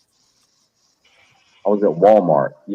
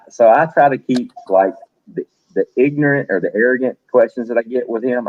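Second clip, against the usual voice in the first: usual speaking voice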